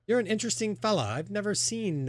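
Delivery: put-on high, curious kid voice; young halfling kid. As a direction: marvel at the stranger in a high young voice. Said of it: "a young kid".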